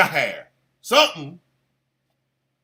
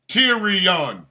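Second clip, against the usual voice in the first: normal talking voice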